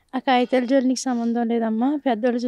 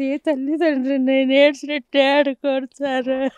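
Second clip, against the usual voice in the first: tearful plea; 3 dB louder, slower than usual. Real, not acted. Grieving mother begging the reporter for justice, tearful. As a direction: voice breaking with grief, slow.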